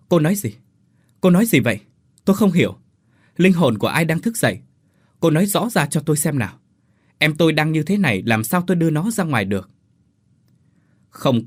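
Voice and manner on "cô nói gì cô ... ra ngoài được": higher, louder, agitated demand; urgent questioning. A narrator's reading. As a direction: press for an answer, pitched up. Confused brother urgently questioning unseen girl.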